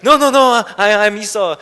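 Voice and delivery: a son lying to his blind father; panicked denial. Retold or imitated. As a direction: deny it fast, caught in the act. Jacob denying, caught out.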